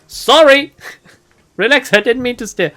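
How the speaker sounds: high, loud, protesting sorry; defensive mock apology. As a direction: protest loudly at being told off. Player answers the orc's scolding.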